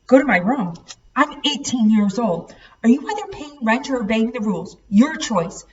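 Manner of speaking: father snapping at his grown son; snapped. A narrator's reading. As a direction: snap it back at him. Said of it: "Chase Dad snapped".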